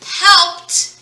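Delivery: "helped" pronounced helpt, one syllable; final T sound. Shown as a demonstration. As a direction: hit the T at the end of helped. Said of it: "We actually hear the T sound".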